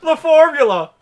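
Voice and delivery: high, very loud catchphrase yell; over-the-top high shout; mock shouting. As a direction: holler it for a silly bit. Repeating the bit at full volume.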